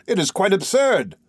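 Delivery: mildly exclaimed, with a firm falling end; exclaiming. A narrator's reading. Nephew protesting the absurd journey to himself, exclaiming. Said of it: "I cried".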